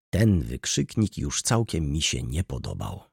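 ordinary delivery, nothing marked

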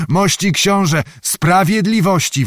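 high-pitched outraged plea; crying out for justice. A narrator's reading. Enraged guard bursts in on the prince demanding justice. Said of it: "wołał".

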